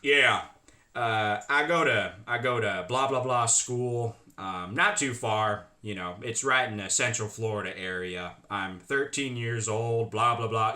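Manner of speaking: put-on old guy's voice. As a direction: drone on deep and unhurried. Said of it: "he still thinks he's talking as a girl".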